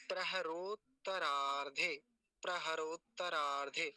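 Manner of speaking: "प्रहरोत्तरार्धे" slowly, every syllable held clear; joined-up slow demo. Shown as a demonstration. Teacher joining all the parts for learners, careful pronunciation. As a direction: sound out "प्रहरोत्तरार्धे" slowly as one word. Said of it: "सारे मिलाएंगे".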